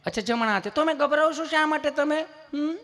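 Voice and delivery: high, cooing nurse voice; put-on sweet nurse, hum at the end; gentle nurse. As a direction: soothe the patient in a high, loving pitch. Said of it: "તમે કેટલું પ્રેમથી પૂછો છો".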